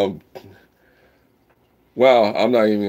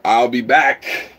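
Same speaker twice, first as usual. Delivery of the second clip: Schwarzenegger impression; 3 semitones higher, 5 dB louder, much slower than usual. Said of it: "more like Schwarzenegger".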